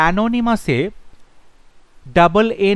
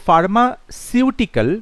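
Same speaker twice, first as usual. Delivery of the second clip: usual speaking voice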